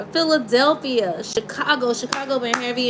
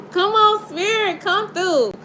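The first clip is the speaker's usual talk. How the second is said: excited calling spirit; 7 semitones higher than usual. Real, not acted. Tarot reader calling on the spirit, laughing and excited. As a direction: call the spirit in, thrilled.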